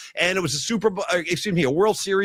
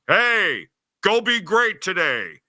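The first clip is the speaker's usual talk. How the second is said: slow, calm put-on Joe Buck voice; a cool broadcaster telling you to go be great; imitating Joe Buck; much slower than usual. Host retelling Joe Buck's 4 a.m. greeting, lightly voiced imitation. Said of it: "I heard a voice to my left, and he said".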